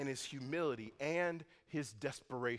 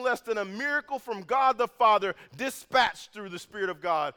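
higher and louder, pressing forward; fervent preaching; 7 semitones higher, 10 dB louder than usual. Acted. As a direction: build the moment up with urgency. Preaching at full force.

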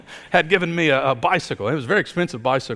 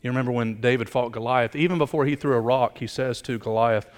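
usual speaking voice

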